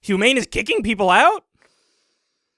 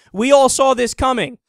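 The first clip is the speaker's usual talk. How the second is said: ordinary talk, nothing marked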